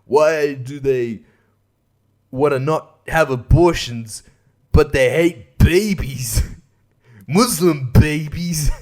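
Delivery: slow, drawn-out earnest preaching; slow sanctimonious mock comic voice; mocking preachy comic. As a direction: parody a self-righteous comic. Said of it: "this isn't even one of his jokes".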